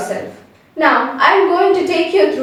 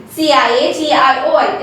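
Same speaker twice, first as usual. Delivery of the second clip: ordinary delivery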